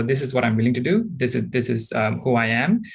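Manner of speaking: ordinary delivery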